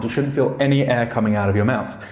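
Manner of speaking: ordinary talk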